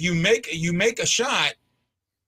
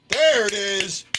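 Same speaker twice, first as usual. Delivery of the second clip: excited call; 4 semitones higher, 5 dB louder, slower than usual. Real, not acted. Still celebrating the goal.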